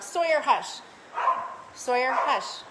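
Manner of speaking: loud, firm hush to a barking dog; scolding the dog. Real, not acted. Scolds barking dog mid-stream.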